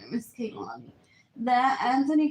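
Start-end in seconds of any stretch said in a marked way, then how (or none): 0.1-0.9 a breathy secret confession; whispered aside about missing her; whispered longing. Real, not acted. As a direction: whisper it to yourself. Wistful aside after boredom.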